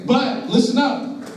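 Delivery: high, drawn-out call to the room; slow it right down and pitch it up to win the crowd back; calling for attention. Real, not acted. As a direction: rein in a chattering audience, high and slow. Calling a noisy young audience back.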